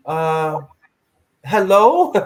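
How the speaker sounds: high, loud mock-incredulous hello. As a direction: play it like she can't believe her ears. Retold disbelief at sister singing.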